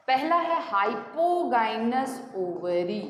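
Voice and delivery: slow, deliberate naming of the term; slow dictation. Shown as a demonstration. Teacher naming the first ovary condition, slow and clear. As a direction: lay out "hypogynous" slowly and clearly.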